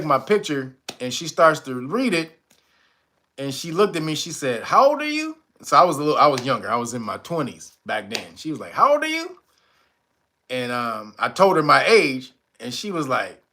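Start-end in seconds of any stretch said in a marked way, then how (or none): 4.6-5.4 mock surprised lady voice, much higher; high, startled question from the reader; lady asking. Aura reader staring at him, high surprised voice. Psychic lady asks his age. 8.7-9.4 mock surprised lady voice, much higher; high, startled question from the reader; lady asking. Aura reader staring at him, high surprised voice.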